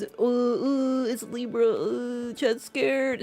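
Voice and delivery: mock scared chat. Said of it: "Chat's the one who's".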